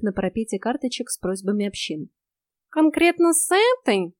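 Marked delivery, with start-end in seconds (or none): 2.7-4.1 high, slow, reluctant cat voice; wincing cat. A narrator's reading. Cat eyes the vampires' request card, reluctant. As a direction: stretch the question out with distaste. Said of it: "поморщился Васька".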